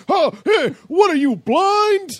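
loud, high, drawn-out heckle; mock outraged yell. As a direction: holler at a careless driver, hammed-up. Pedestrian nearly hit yells at driver.

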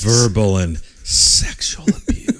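slow breathy sultry whisper. Pretend. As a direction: purr the list slowly, seductively. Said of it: "Do it in your sexy Mike voice".

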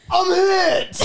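high, loud Boston-accent soldier cry. Shown as a demonstration. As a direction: shout it laughing in a Boston accent. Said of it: "I'm gonna need it in a Boston accent".

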